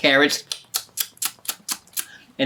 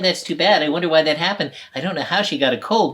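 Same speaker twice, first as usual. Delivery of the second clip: usual speaking voice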